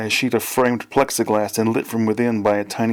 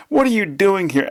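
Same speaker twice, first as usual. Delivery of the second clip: high-pitched shocked shout; 10 semitones higher than usual. A narrator's reading. Son to his father springing out, high shout. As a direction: cry out high at the intruder.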